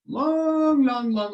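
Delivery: high, loud drawn-out long; dramatic opening. Acted. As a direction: hook the king from the first word. Storyteller opens dramatically.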